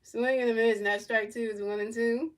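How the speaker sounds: lightly voiced broadcaster calling the at-bat; mock play-by-play. Fan calling the game live to stream viewers, mock broadcaster. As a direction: narrate each pitch like a TV booth.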